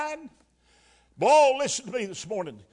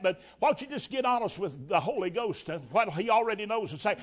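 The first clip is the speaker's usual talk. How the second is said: ordinary talk, nothing marked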